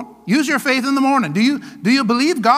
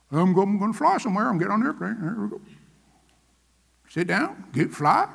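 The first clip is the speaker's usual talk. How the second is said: quieter, fast mock of a passive traveler; lightly imitating a flyer who just hopes; faithless flyer; 3 semitones lower, 6 dB quieter than usual. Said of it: "do you just get on the airplane".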